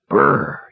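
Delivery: high, shuddering "brr"; shivering brr. A narrator's reading. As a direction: shudder it out with trilled lips. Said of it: "he shivered".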